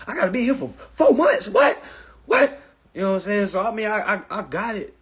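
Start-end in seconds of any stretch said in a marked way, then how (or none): 0.1-2.6 high, loud, dismayed outburst; hammed-up high-pitched protest; mock distressed disbelief. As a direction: wail it like a first night locked up. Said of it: "I cried first night 'cause you like".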